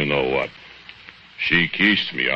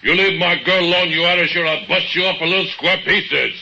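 much higher and louder angry yell; jealous Bogdan yelling at the Irishman; furious shout; 12 semitones higher, 4 dB louder than usual. Acted. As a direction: roar the threat at the rival. Bogdan bursts in, threatening rival.

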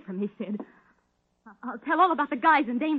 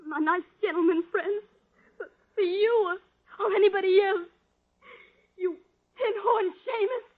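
tearful outburst; 6 semitones higher than usual. Acted. Cornered woman lashes out at Sid.

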